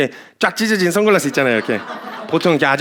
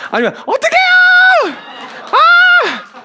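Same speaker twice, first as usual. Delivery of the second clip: much higher, louder drawn-out mock shriek; panicked shriek; 4 dB louder, much slower than usual. Woman left on the ramp shrieking after the truck, mock panic. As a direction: shriek as your truck drives off.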